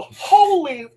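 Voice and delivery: high, loud exclamation; retold shock. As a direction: blurt it in astonishment, cut short. Retelling shock at ghostly reply.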